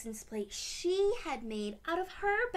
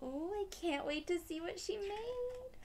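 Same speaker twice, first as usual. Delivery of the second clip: higher, eager anticipation; can't wait to peek; excited; 8 semitones higher than usual. Real, not acted. Reader eager to see Anjali's creation.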